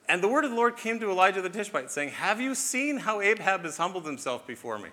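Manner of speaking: thrilled reading of God's words; excited. Acted. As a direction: read it aloud with rising delight. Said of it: "He almost sounds excited".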